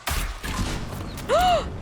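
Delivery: high, sharp gasp; shocked gasp. Real, not acted. Player spotting companion in trouble, shocked gasp. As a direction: suck in air in alarm. Sees who needs help.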